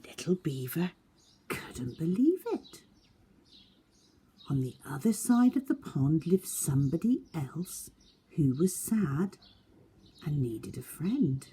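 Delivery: put-on lively read-aloud for young children; story narration. A narrator's reading. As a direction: tell it to a class of seven-year-olds. Reads picture book aloud.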